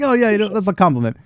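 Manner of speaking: high, fast smug brute; smug goblin brute. Acted. Goblin brute bragging, high and fast. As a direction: toss it off fast and pleased.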